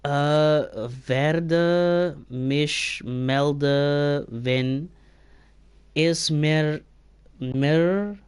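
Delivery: slow, halting sounding-out of foreign words; struggling to read German. Real, not acted. Streamer trying a German message aloud, slow and halting. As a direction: stumble through unfamiliar German. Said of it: "I can't even say any of these words".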